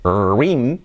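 "rim" as one drawn-out word; one-word "reem"; mistaken pronunciation. Shown as a demonstration. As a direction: say rim as a slow single syllable. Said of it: "de decir rim".

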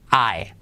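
"ae" alargado, acabado en e abierta; diptongo "ae". Shown as a demonstration. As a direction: di "ae" despacio, no "ai". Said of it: "va a ser". ("ae" lento, terminado en e)

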